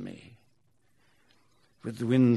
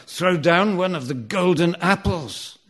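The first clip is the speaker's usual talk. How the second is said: loud, higher urgent call; shouting; 6 semitones higher, 9 dB louder than usual. Acted. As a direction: yell it to the back of the truck. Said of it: "I shout to the girls".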